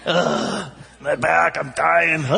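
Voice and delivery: whiny, hammed-up moan about a sore back; imitating a complaining woman on the plane, mock pained; mock groaning passenger. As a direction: moan it like a fussy seatmate.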